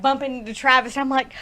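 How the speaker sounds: ordinary talk, nothing marked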